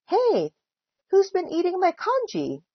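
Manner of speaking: high-pitched hey, then demanding question; storybook indignation, pitch well up; indignant demand. A narrator's reading. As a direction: demand to know the culprit. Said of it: "demanded Mister Chan".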